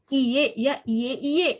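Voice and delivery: slow drawn-out iye ya iye iye; demo pronunciation. Shown as a demonstration. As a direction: say each iye slowly and clearly. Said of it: "Jadi perhatikan iye dan iye iye ya".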